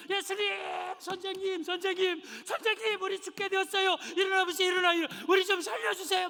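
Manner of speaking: high, loud desperate cries for help; panicked plea. Acted. Disciples in the sinking boat begging Jesus, panicked high cries. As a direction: beg the sleeping master to wake, terrified.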